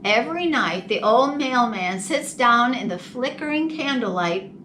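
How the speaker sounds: usual speaking voice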